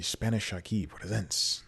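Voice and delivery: put-on Spanish accent on the name. Shown as a demonstration. Podcast host naming an improv group, put-on Spanish accent. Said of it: "did I have to do the accent".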